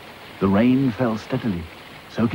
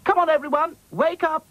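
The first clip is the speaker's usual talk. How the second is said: high calling voice, much higher than narration; calling out to wake them. Acted. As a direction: rouse the sleeping dolls, high and bright. Dotty wakes the sleeping dolls.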